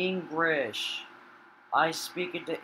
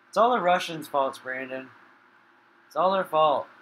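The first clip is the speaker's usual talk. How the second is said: mock rant; 2 semitones higher, 8 dB louder than usual. Real, not acted. Ranting at Russian players.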